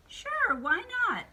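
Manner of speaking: high, loud witch reply; high-pitched storybook witch; agreeable witch. Acted. Witch agrees to more cookie.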